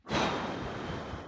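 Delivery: long, soft, voiceless sigh. Real, not acted. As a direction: let out a long breath. Long breath out in a pause.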